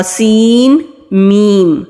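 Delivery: سین میم drawn out slowly, no نون sound; correct recitation. Shown as a demonstration. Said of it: "سین پڑھ کے پھر ہم میم کو پڑھیں گے". (سین میم بغیر نون کے آہستہ)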